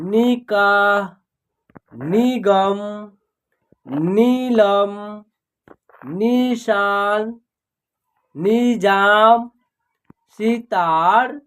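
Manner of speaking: drawn-out words with long pauses between; slow word drill. Shown as a demonstration. Teacher modelling words for learners to read, slow drill. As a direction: read each word slowly, stretching the vowels.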